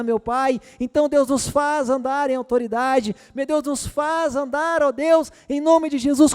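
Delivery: high, loud, impassioned prayer; prayer building to a fervent shout; fervent preaching prayer. Acted. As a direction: cry out to God with full force.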